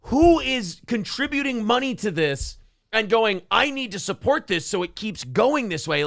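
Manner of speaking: high-pitched incredulous reaction; retold disbelief. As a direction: act out baffled disbelief at the donors. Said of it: "and I go".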